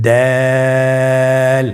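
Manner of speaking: দাল with the vowel held three counts; Arabic letter with three alif lengthening; three alif stretch. Shown as a demonstration. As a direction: draw দাল out long and thin. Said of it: "একবার চেষ্টা করুন".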